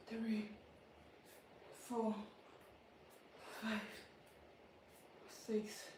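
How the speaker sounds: soft, worn-out counting; tired quiet count. Real, not acted. Counting slow reps after saying she is tired, quiet. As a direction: count under your breath, spent.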